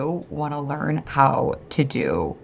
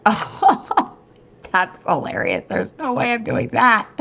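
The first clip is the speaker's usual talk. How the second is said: mock laugh, then high-pitched disbelief; hammed-up nervous laughing refusal; 5 semitones higher than usual. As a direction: scoff and laugh off the very idea. Acts out bailing out atop slope.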